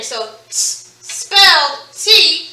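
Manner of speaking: "t" as a breathy sound, then "T" as the letter name, slowly. Shown as a demonstration. Teacher contrasting a sound with its letter name, slowly. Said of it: "those slashes mean you make the sound".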